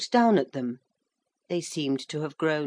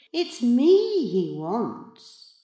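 higher, very drawn-out gloating; smug cat in boy's body; 7 semitones higher, 4 dB louder, much slower than usual. A narrator's reading. Giant reflection claims the father's call, gloating slowly. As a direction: savour each word in triumph.

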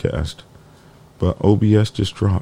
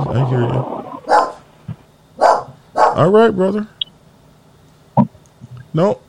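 high coaxing voice to a dog; high sing-song dog talk; 10 semitones higher than usual. Real, not acted. Talking to barking dog at door.

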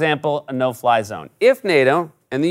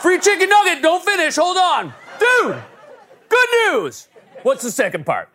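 excited interrupting; 14 semitones higher than usual. Acted. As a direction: cut in before the catch.

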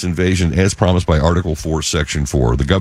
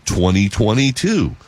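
ordinary talk

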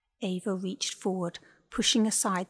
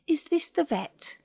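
phone caller; 8 semitones higher, 3 dB louder than usual. A narrator's reading.

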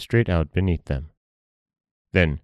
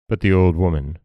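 plain reading voice

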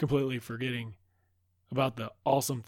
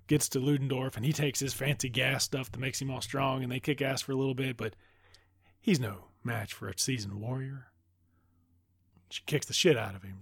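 ordinary delivery

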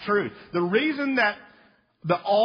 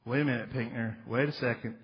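lower, softer skeptical questioner; mock objector; 8 semitones lower, 5 dB quieter than usual. A preacher voices a doubter questioning him, lower and softer. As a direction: play the doubting listener, low and hushed.

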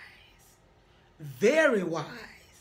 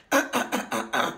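throat clearing; 12 semitones lower than usual. Acted. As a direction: clear the throat before a speech. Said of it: "he cleared his throat".

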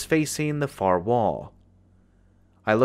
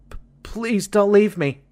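put-on much higher, small scared little-boy squeak; frightened squeak; 8 semitones higher than usual. A narrator's reading. Small boy begging the man not to leave, scared squeak. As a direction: squeak it out like a terrified child. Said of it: "I heard myself squeak out".

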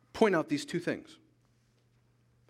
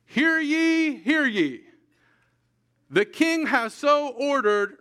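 high, loud herald's proclamation; town crier; 8 semitones higher, 5 dB louder, slower than usual. Retold or imitated. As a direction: call it out like a royal herald. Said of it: "and he's like".

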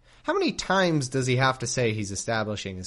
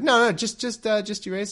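higher and louder, panicky pleading; frantic Abram; 9 semitones higher, 5 dB louder than usual. Retold or imitated. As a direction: Abram begging, voice jumping up. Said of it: "He's like".

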